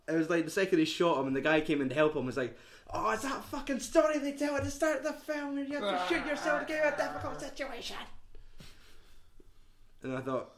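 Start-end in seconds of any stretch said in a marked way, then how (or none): 2.9-8.0 high, strained character voice; acted film character. Retelling the shooting scene as a character, high-pitched. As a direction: push it up high and rush the end.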